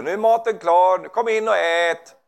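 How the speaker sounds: högt utrop, sista delen långsamt; ropar att maten är klar. Retold or imitated. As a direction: ropa in familjen till middagen. (gumman ropar in gubben till maten, högt rop)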